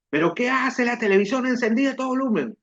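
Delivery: a mother catching the TV on; mom scolding. Retold or imitated. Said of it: "me grita". (mamá regañando al ver la tele prendida, con voz más aguda)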